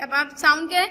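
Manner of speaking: normal talking voice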